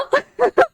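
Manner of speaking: high, loud burst of laughter; short high cackle of vindication; gleeful laugh. Real, not acted. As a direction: laugh in triumph. Laughs at being proved right.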